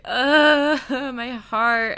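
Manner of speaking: loud, high "ugh" breaking into a laugh; swooning groan. Retold or imitated. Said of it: "I'm just like".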